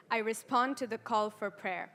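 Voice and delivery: ordinary talk, nothing marked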